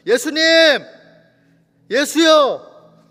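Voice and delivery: loud, high cry drawn out at the end; screaming. Acted. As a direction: call out desperately at full voice. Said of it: "고래고래 소리 질러요". (고래고래 소리쳐 부르기)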